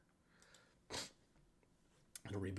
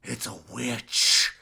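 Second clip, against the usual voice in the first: loud, drawn-out cry of alarm; hammed-up, stretched-out shout; screamed alarm; 3 semitones higher, 11 dB louder, slower than usual. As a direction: scream it out as the one leading the pack.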